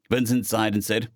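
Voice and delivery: usual speaking voice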